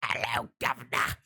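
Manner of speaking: hammy British monster voice; killer taxi. Retold or imitated. Possessed taxi hunting down guys, hammed-up British menace. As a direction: put on a menacing mock Cockney greeting. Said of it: "this taxi chasing down guys saying".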